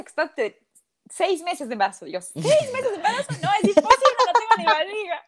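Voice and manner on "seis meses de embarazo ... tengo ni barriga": high, loud incredulous outburst; shocked disbelief. Retold or imitated. Retelling her reply to being six months pregnant, shocked disbelief. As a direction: protest it at a much higher pitch, aghast.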